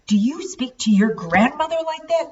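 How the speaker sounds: a father thundering at his son; thundered. A narrator's reading. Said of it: "Chase Dad thundered".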